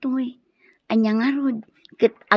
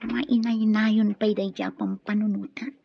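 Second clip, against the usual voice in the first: ordinary talk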